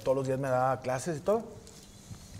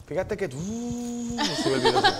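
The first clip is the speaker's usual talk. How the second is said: laughing, very high pitch; giggling dad's voice; 9 semitones higher, slower than usual. Retold or imitated. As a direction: laugh through the words like a gleeful dad.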